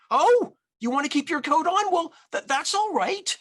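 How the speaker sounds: flustered granny. A narrator's reading. Grandmother covering for her guest's roar, flustered. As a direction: babble kindly past the roar.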